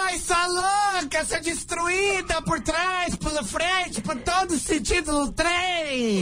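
high, slow, drawn-out insults building to shouts; mock insulting diva. Prank caller insults the woman on the phone, high slow mock shouts. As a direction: hurl each insult like a catty diva.

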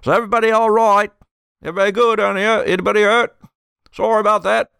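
high, fast shout across the water; hurried ferry worker yelling down; ferry operator yelling. Acted. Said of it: "yells across".

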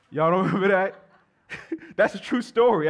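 laughing through the words. Real, not acted. Preacher laughing with the congregation about the story, amused. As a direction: chuckle at the memory.

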